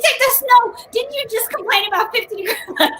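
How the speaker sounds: giggly playful call-out; laughing tease. Real, not acted. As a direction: tease her through laughter. Teasing cohost, laughing.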